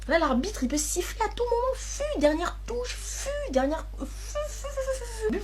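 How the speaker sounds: frantic high cry. Acted. Final whistle, urging old folks.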